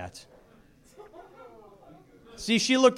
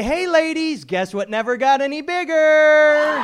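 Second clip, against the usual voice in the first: gleeful taunt; 8 semitones higher, 7 dB louder than usual. Retold or imitated. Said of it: "and say".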